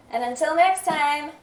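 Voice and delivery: ordinary talk, nothing marked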